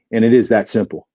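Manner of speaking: ordinary delivery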